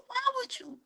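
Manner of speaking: hammed-up tiny squeal; mock squeaky whine. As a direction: squeak it out like a wounded friend. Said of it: "I was like".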